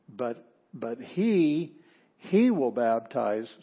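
he stretched out slowly, then hit harder; emphatic HE. Shown as a demonstration. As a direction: draw out he with force. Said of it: "that is emphasis".

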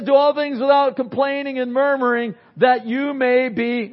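preacher reciting Scripture with weight; scripture reading. Acted. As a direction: proclaim the verse to the congregation. Reads scripture aloud.

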